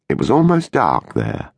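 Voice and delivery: plain reading voice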